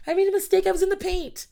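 put-on high, loud kid's confession; dziecko przyznające się do winy. Acting out child's confession.

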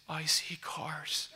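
hushed, drawn-out whisper of disbelief; tearful awe. Retold or imitated. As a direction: imitate her stunned whisper, very slowly. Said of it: "tears started running".

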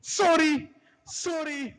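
high, loud squeaky apology; flustered manager. Retold or imitated. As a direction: squeal sorry while fleeing. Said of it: "Oh, shit".

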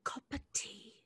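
whispered British "Cup of tea" with the voice dropped away. Acted. Student copying the teacher, whispering British "Cup of tea". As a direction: breathe "Cup of tea" in a hushed British accent. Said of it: "try a little softer cup of".

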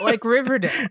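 high embarrassed laugh. Real, not acted. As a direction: confess it with a giggle.

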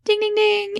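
high game show chime; ding ding chime. Pretend. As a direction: sing the winning bell. Game show correct answer chime.

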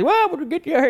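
high, twangy Southern tow-truck voice; imitating a Southern tow driver; Mater Southern drawl. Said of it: "He sounded just like that".

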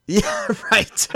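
high burst of amused agreement; laughing agreement. Real, not acted. Co-host agrees the handgun bazooka is silly, laughing. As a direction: crack up while agreeing, voice leaping up.

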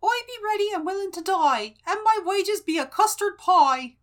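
high-pitched, rustic ditty voice; [name]. Acted. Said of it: "he sings a little ditty".

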